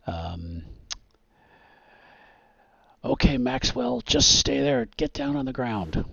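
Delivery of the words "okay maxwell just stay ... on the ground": raised, higher-pitched police command; cop's yell. Acted. Cop to a cornered suspect after a crash, yelling orders. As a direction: call out an order like a cop at a standoff. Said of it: "one of the cops yell".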